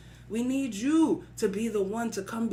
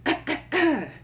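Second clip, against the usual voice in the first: staged, higher-pitched throat clearing; 6 semitones higher, 4 dB louder than usual. Acted. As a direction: clear your throat in preparation to speak. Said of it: "He cleared his throat".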